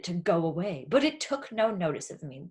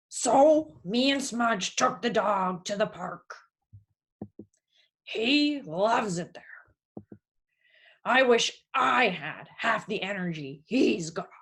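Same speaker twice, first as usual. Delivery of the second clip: lightly voiced tired working man; a gentle, resigned dad; weary unemployed father. Jobless father musing on hope, lightly voiced.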